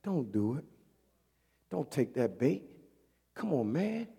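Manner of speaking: gentle urging. Retold or imitated. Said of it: "Him and Jesus saying".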